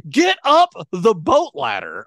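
loud, slow, high bark of an order; impatient shout; mock yell. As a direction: yell it up at a teammate stuck on the ladder. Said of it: "I'd be like".

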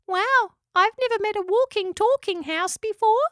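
very high, amazed squeaky voice; little echidna. A narrator's reading. Spike marvels at a talking house, little echidna voice. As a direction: marvel in a tiny creature's voice.

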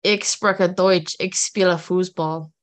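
slow, careful German; a learner's stiff German accent; bad German accent. Shown as a demonstration. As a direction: spell out "Ich spreche Deutsch" word by word in a German accent. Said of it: "my bad accents".

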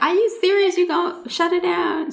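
high, disbelieving question; incredulous. Retold or imitated. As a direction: ask it in total disbelief. Retold disbelieving reply to friend.

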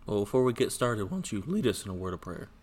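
ordinary talk, nothing marked

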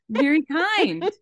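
high, loud and slow, laying the praise on thick; gushing praise. Acted. Host praising Carrie Ann while mocking Allie, gushing. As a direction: stretch it out like over-the-top flattery.

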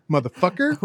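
ordinary talk, nothing marked

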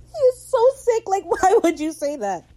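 high, loud outburst breaking into laughter; scandalized delight. Real, not acted. Recapper reacts to the syphilis joke, scandalized laughing outburst. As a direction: shriek it, appalled and amused.

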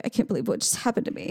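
quick, understated woeful mutter; mock somber. Dog leaving the vet head down, mock somber. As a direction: play the wounded dog, lightly somber. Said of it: "He was all somber".

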